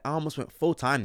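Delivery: ordinary delivery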